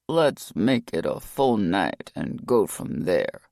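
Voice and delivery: put-on slow, low Chicago mobster accent. A narrator's reading. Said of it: "donning his best Chicago accent".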